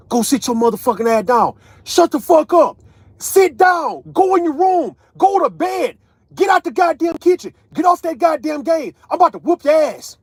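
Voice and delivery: loud, high-pitched scolding shout; yelling parent. Acted. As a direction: yell orders at misbehaving kids. Acts out parent yelling at kids.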